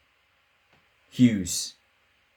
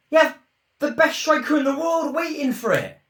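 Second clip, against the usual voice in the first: higher, louder outraged cry; exasperated yell; 5 dB louder than usual. Real, not acted. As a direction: vent at the wasted chance. Exasperated at missed chance.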